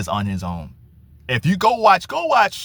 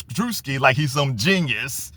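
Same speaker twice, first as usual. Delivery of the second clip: usual speaking voice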